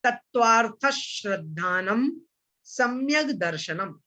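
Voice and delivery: तत्वार्थ श्रद्धानम, then a pause, then सम्यक दर्शनम, all slow; slow model recitation. Shown as a demonstration. Teacher repeating the sutra for students, slow with a pause. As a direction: give a clear pause after श्रद्धानम. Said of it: "फिर से बोल रहा हूं, ध्यान से सुनिएगा".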